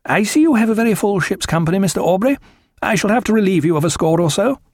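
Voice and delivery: put-on high, brisk, officious young officer; officious young captain. A narrator's reading. Young cutter captain addressing Aubrey, officious and high-pitched.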